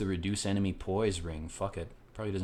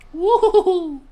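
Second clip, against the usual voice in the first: high-pitched loud whoop; 11 dB louder than usual. Real, not acted. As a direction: whoop like a cheering fan. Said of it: "celebrating content".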